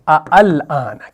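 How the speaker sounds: अअल आना said slowly, both a sounds drawn out; original form. Shown as a demonstration. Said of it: "ये था ऐसे".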